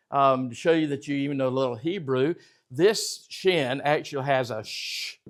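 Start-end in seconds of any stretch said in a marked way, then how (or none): 4.6-5.2 drawn-out hushing "sh"; "sh" sounded alone, like shushing. Shown as a demonstration. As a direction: hold "sh" as one long consonant, no vowel.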